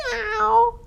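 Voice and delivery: thin, high, soft meow; cat yowl. A narrator's reading. Skinny cat chased by a dog, high meow. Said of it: "yowled skinny".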